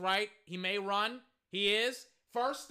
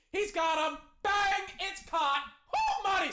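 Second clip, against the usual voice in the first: higher, louder burst of excitement; excited play call; 7 semitones higher, 4 dB louder than usual. Real, not acted. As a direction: call the big catch with a whoop. Calling a big completed pass.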